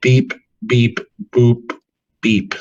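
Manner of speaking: robot beeping noises; droid beeps. Pretend. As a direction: chirp like a little droid.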